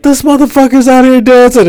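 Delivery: high-pitched incredulous griping; exasperated disbelief. Retold or imitated. Driver phones friend about dawdling passenger, exasperated.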